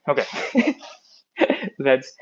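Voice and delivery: winded, laughing it off; out of breath. Real, not acted. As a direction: catch your breath while talking. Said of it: "I'm so out of breath today".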